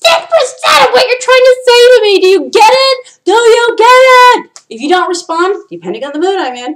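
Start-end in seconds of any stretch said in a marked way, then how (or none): 0.0-4.5 high, loud shout of exasperation; frustrated shout. Real, not acted. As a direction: yell the question at them. Ranting at unclear texters.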